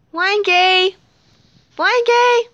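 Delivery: high, loud singsong call. Pretend. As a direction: call out for a missing playmate. Calling out for missing Frankie.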